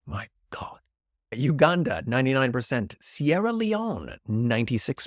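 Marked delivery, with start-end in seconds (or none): 0.1-0.8 under-the-breath whisper; whispered exasperation. Real, not acted. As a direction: breathe it out in disbelief. Whispered aside.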